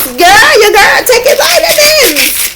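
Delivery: high, laughing shout, slowed down; excited laughing shout. Real, not acted. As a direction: crow it out with a laugh. Bragging about new healthy habit.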